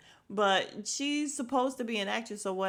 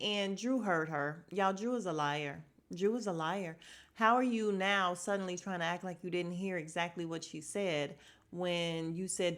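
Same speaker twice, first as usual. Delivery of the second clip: usual speaking voice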